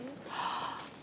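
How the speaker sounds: soft breathy gasp of delight. Real, not acted. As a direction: gasp as if amazed. Teacher reacts to the drawing.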